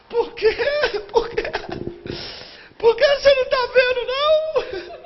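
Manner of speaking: high, tearful, shrill-pitched excited woman; crying fan. Retold or imitated. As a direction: play the sobbing fan, pitch way up. (fã chorando em voz aguda)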